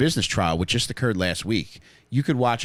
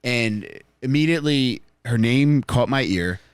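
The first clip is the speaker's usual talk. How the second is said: slow dramatic; slower than usual. Acted. Romantic build-up to the crush.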